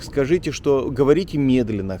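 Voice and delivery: ordinary talk, nothing marked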